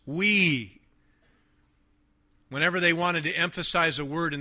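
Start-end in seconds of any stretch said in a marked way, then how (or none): 0.1-0.8 "We." set apart and emphasized; emphasis on We. Shown as a demonstration. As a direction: stress "We." as one drawn-out word. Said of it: "The emphasis".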